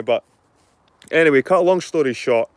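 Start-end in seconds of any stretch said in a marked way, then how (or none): none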